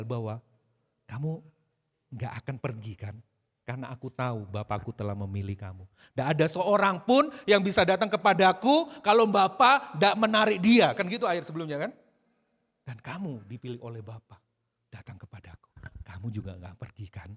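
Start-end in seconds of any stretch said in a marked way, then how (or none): 1.1-5.9 low, tender reassurance; full of love. Acted. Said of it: "penuh kasih kepada murid-muridnya". 12.9-17.3 soft, low, almost whispered tenderness; speaking with love. Acted. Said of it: "Itu Yesus ngomong dengan kasih".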